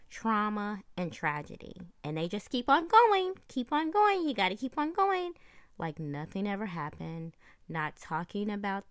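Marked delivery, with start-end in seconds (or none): 2.0-5.3 high, loud robot-style chant. Pretend. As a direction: raise it into a loud, relentless programmed chant. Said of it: "that was my robot voice".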